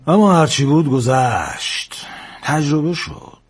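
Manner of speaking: slow sigh of resignation; bitter recollection. A narrator's reading. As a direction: draw the words out over a bitter memory.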